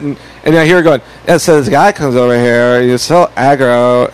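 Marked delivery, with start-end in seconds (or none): 1.3-4.1 slow, low drawled mock complaint; draggy mock-whiny girl; mocking imitation. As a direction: imitate her griping on the phone, dragging the words.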